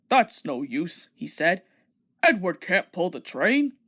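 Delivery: deep proud engine scoffing; big engine voice. Acted. Gordon dismissive.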